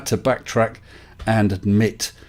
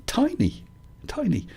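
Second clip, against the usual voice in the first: whisper; 12 semitones higher, 8 dB quieter than usual. Real, not acted. Marvelling at little astronauts.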